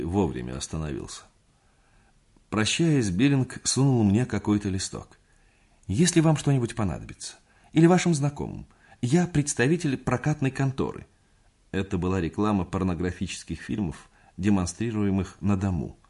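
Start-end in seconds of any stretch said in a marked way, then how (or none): 5.9-11.1 ingratiating. A narrator's reading. Billing offers services.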